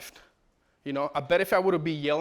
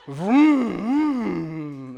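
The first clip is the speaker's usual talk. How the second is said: imitated car revving; 3 dB louder than usual. Father imitating the car video, revving vrooms. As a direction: rev it up like an engine. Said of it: "just sound of a car".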